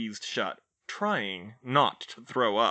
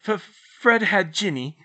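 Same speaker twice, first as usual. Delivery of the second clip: stammering; 8 semitones higher, 9 dB louder than usual. A narrator's reading. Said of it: "George stammered".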